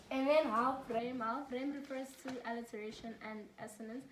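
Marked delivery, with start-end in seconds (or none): none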